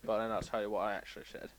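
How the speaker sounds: normal talking voice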